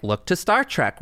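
normal talking voice

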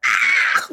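piercing high scream; internal scream let out. Acted. Moviegoer reacting to the game, piercing mock scream. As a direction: shriek as if in a movie theater. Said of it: "the inaudible scream, the internal one".

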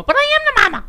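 high squealing exclamation; excited squeal. Real, not acted. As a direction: squeal it out with glee. Playful mama exclamation.

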